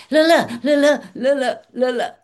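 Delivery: mock rambling babble. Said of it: "she just over-explained".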